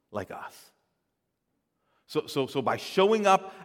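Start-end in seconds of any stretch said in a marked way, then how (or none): 0.1-0.7 breathy near-whisper, almost no voice; hushed "like us" to close the thought. Real, not acted. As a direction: let it fall to a hush. Soft close of a sermon point.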